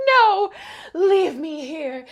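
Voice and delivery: high then drawn out; dramatic mock plea. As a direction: overplay a tragic refusal. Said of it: "Kaya just like".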